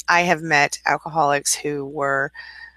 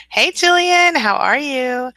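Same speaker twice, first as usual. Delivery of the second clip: excited greeting; 9 semitones higher, 3 dB louder, much slower than usual. Real, not acted. Greeting co-author arriving in chat.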